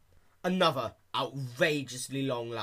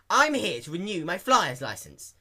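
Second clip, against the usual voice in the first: higher, louder impatient pony voice; fed-up Rainbow Dash; 7 semitones higher, 9 dB louder than usual. A narrator's reading. Dash demanding her license renewal, impatient and loud. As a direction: push it out, already annoyed. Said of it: "Dash replied in a fed-up tone".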